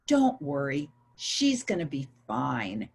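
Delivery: low, slow reassuring voice; gentle reassurance. Retold or imitated. Nurse comforting a worried husband, gently reassuring. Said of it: "a nurse go over to him and put her hand on".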